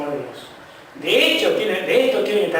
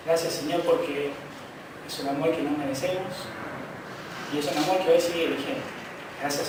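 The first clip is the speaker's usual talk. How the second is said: closing prayer; 2 semitones lower, 4 dB quieter than usual. Acted. Pastor prays for the church after the sermon, soft prayer. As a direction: pray gently for the whole church.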